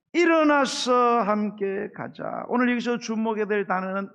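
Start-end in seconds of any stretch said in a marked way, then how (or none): 0.1-2.4 drawn out and louder, each word weighted; slow emphatic reading. Acted. Pastor reading God's call to the congregation, slow and emphatic. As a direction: linger on 일어나서 함께 가자 slowly and firmly. Reading the Song of Songs verse.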